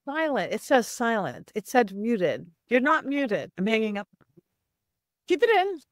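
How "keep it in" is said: squeaky high voice. Real, not acted. Mock squeal at host.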